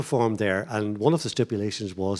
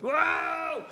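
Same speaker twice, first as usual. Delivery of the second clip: high mock crowd "Wow!"; crowd cheer. Telling of the crowd's cheer, a high mock "Wow!". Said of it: "the crowd went".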